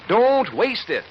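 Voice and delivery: ordinary talk, nothing marked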